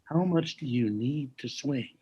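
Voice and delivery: slow, soft touch of New Jersey; hushed, drawn-out New Jersey question; New Jersey accent. Retold or imitated. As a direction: imitate him musing quietly in light New Jersey accent. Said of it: "he had this New Jersey accent".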